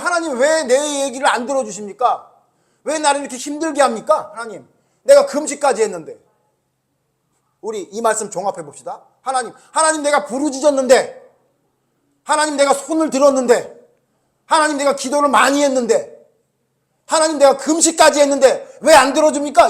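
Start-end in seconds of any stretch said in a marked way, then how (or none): 0.0-6.2 complaining to God. Retold or imitated. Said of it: "그러면서 뭐라 그러냐면". 9.2-19.7 mock indignant protest to God, louder and higher; arguing with God. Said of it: "이러고 하나님께 따진다는 거예요".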